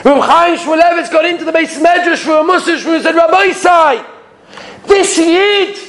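high, impassioned preaching, slowing at the end; preaching at full force. Acted. Rabbi retelling a Mussar shmuz, impassioned high preaching. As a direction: cry it out to the hall.

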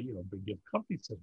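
ordinary talk, nothing marked

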